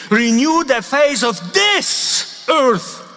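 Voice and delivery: slow, solemn, each word weighed; slow repeated prayer. Retold or imitated. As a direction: repeat it slowly like a blessing. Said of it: "And he repeated".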